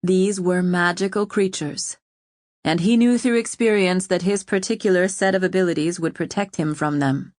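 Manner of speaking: usual speaking voice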